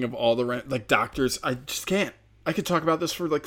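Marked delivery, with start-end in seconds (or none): none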